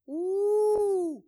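high, loud mock-shocked ooh; a gleeful roast ooh. Real, not acted.

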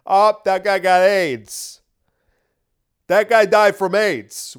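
loud sneering impersonation of a dismissive official; mock Fauci voice. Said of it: "doing that voice for Fauci".

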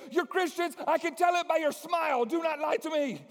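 put-on high, loud, insistent young woman; high eager girl. The Chinese girl confronting the team, high and insistent. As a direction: press them, high and pushy.

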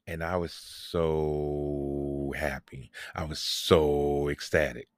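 long, held-out "so" each time, low pitch; slow and low, dwelling on "so"; drawn-out SO. Real, not acted. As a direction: stretch "so" right out, slowly, for emphasis. Stretches SO for comic emphasis.

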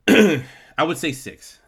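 throat-clearing through the words; talking through a cough. Real, not acted. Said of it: "Sorry for the coughing".

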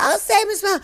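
inmates calling out. Retold or imitated. Inmates calling to the guard, high mock shout. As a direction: squeal it out like a rowdy inmate.

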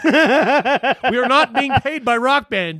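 slow protest broken by laughter; laughing. Real, not acted. Co-host laughing while insisting they aren't sponsored. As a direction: laugh through the disclaimer.

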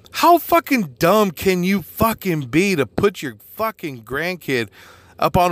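slow, high, indignant words about the grandfather; outraged disbelief. Real, not acted. Venting to listeners about the careless grandpa, slow and outraged. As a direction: drag it out in angry disbelief.

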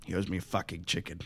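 low, quiet deadpan threat; menacing character. Acted. Retelling a fantasy show's killer explaining himself, low deadpan. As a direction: play him low and matter-of-fact, under the breath. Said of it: "He's like".

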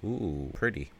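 softly voiced retold ogling; quiet admiring aside. Officer eyes wife coming downstairs, quiet admiring aside.

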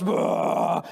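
drawn-out "boah" battle cry; charging roar. Acted. Storyteller acting Goliath charging at David, roaring. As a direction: roar like a giant starting his charge.

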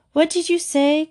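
put-on high-pitched questioning child, as read aloud; puzzled asking. A narrator's reading. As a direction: ask it high, not having heard. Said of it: "Cornelia asked".

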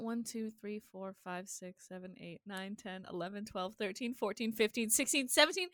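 very soft, low running count; counting under breath. Real, not acted. Counting episodes under their breath.

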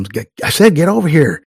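loud, high-pitched order; mock boss's shout; imitated yelling. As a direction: yell impatiently at a colleague across the office. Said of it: "Mike's yelling back at him".

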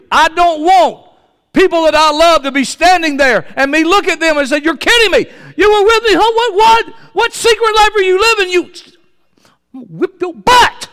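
very high, loud pulpit outburst; outraged shout. Acted. Preacher confronting loved ones with secret lives, outraged shouting. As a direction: yell in disbelief, voice climbing.

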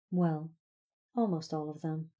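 ordinary delivery

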